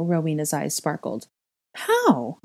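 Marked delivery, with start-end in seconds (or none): 1.7-2.4 high-pitched, demanding question. A narrator's reading. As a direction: demand an explanation. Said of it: "I demanded".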